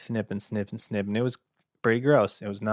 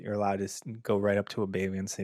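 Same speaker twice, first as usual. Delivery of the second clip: ordinary talk, nothing marked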